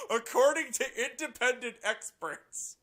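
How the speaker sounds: loud, high, slow mocking read through laughter; mock the words while still laughing; incredulous laughing sneer; laughing mockery. Real, not acted.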